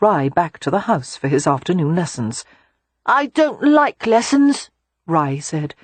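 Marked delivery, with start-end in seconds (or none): 3.1-4.7 put-on high, slow child voice; small boy grumbling reluctantly; sighing little boy. A narrator's reading. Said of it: "heaving a sigh".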